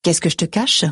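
understated biting retort. A narrator's reading. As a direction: throw the question back with a slight edge. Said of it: "mon ton se fait mordant".

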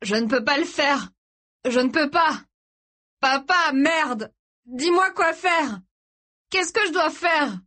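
high, wailing helpless plea; tearful despair. A narrator's reading. Son begging his dying father for guidance, tearful. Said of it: "il se mit à pleurer".

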